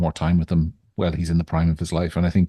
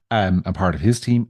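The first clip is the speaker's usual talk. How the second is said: ordinary delivery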